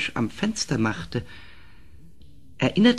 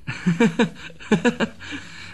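leises, gehauchtes Kichern; spöttisches Lachen; 8 semitones higher than usual. Acted. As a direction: lach den König heimlich aus. Narr lacht über den König. (der Narr kichert hinter vorgehaltener Hand über den König)

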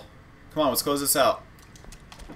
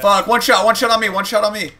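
loud urgent callout, pitched way up; urgent shout; 9 semitones higher, 8 dB louder than usual. Real, not acted. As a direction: yell it out, panicked. Low health callout in firefight.

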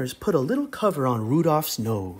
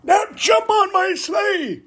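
shouting prospector. Acted. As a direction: bellow like a rough prospector. Prospector urges escape.

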